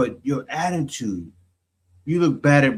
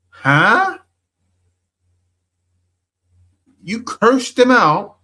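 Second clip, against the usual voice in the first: high, loud disbelief; can't believe what she just heard; incredulous outburst; 8 semitones higher, 5 dB louder, slower than usual. Real, not acted. Commentator reacting in disbelief to the clip, high and loud.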